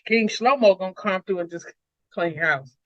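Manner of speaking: ordinary talk, nothing marked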